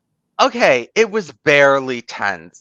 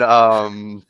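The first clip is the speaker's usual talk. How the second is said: ordinary talk, nothing marked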